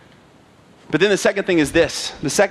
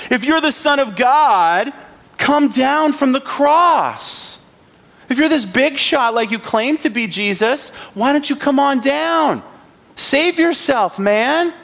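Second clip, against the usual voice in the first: higher, slow mocking call up to the cross; jeering taunt; 8 semitones higher, slower than usual. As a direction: sneer up at him, drawing out the words. Passersby taunting Jesus on cross.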